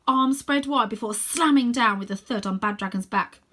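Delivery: usual speaking voice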